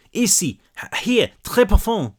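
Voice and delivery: put-on high, distressed French-accented girl; frightened French child, pitched high; broken English, French accent. A narrator's reading. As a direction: stumble through French-accented English, pleading. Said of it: "she said in broken English".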